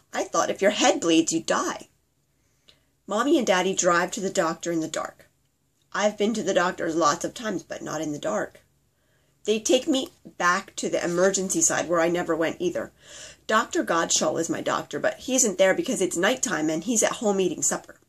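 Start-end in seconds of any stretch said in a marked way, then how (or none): none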